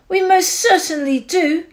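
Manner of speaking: high, loud piping; piping tiny voice. A narrator's reading. Who mayor answering Horton, high piping voice. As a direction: pipe up like a tiny person. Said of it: "piped the voice".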